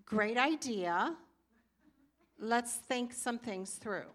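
slow, coaxing agreement; tactful wife easing him along; gentle support. Retold or imitated. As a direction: soften him up, slowly. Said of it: "learned how to come along the side of him and say".

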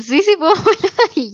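breaking into laughter mid-sentence; laughing while talking. Real, not acted. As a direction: laugh it out as you boast. Proudly claims she can wiggle nose.

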